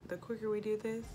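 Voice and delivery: normal talking voice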